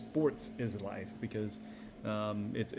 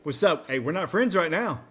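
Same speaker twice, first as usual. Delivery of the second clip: a touch higher and louder, mock trash talk; Mamba mentality; 6 semitones higher, 7 dB louder than usual. Teammate on the court mocking the rival with Mamba mentality, mock trash talk. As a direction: play the cold-blooded competitor, half joking. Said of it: "I like that Mamba mentality where it's just like".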